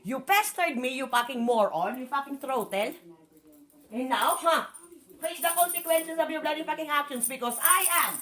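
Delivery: furious tirade at the partner who kept the medicine; angry rant. Real, not acted. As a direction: tear into him for pestering her. Ranting at partner about medicine.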